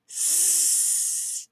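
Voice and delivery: long unvoiced hiss on S; drawn-out s like a snake. Shown as a demonstration. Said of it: "Just like a snake".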